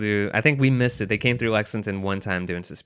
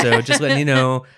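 Claudio impression; 3 semitones higher, 6 dB louder than usual. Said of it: "That is Claudio's voice".

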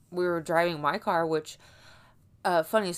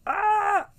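frustrated shout; 13 semitones higher, 8 dB louder than usual. Real, not acted. Stressed outburst about housing.

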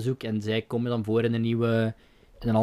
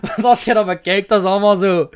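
laughing; 8 semitones higher, 7 dB louder than usual. Real, not acted. Laughing after the meme joke.

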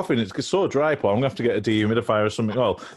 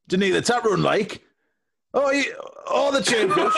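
mock indignant; 10 semitones higher than usual. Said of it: "I said".

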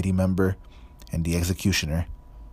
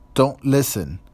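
urgent hoarse urging; 7 semitones higher, 5 dB louder than usual. A narrator's reading. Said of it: "he said hoarsely".

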